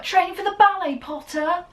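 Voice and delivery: high, loud sneering taunt; mocking Draco. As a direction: jeer it like a spoilt rival on a broomstick. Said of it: "And Draco's like".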